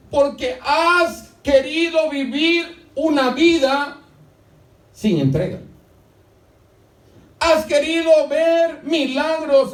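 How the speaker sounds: fervent high sermon shout, slow; full-force preaching. Acted. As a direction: drive God's words home at full voice.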